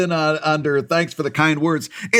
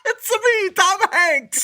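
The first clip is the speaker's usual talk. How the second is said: very high cartoon Italian voice; Mario; slower than usual. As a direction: squeal it in a hammed-up Italian cartoon voice. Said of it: "doing a voice actor doing Mario".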